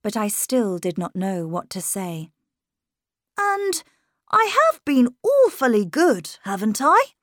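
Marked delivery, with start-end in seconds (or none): 3.4-7.1 put-on high, louder young boy, earnest; a boy insisting he's grown. A narrator's reading. Miles telling his governess he's growing up.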